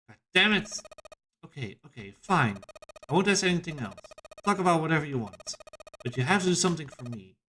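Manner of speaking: high-pitched, grudging character voice; exasperated character. Acted. Character agrees to stop asking questions, exasperated.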